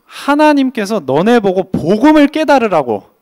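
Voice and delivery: urging listeners to grasp the gospel, full preaching force; forceful preaching. Acted. Preaching climax.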